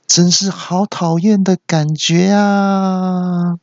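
high, slow hammed-up cartoon villain; Pokémon villain line. Quoting a Pokémon villain, slow and high. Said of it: "套一句宝可梦的台词".

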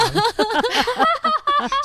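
high, loud real laugh bursting out; burst of laughter. Real, not acted. Recalling strangers coaching a cockroach kill, real laughter. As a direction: break into helpless laughter.

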